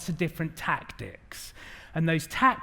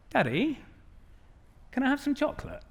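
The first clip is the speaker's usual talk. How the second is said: put-on a higher, sweet little girl asking; sweet child voice; 8 semitones higher, 4 dB quieter than usual. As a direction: play a five-year-old asking nicely.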